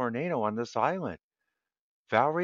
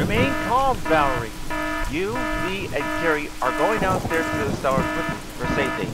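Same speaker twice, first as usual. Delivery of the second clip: raised, higher voice taking charge; urgent instructions; 6 semitones higher, 4 dB louder than usual. Acted. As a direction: call out firmly over a storm. Fred takes charge in storm.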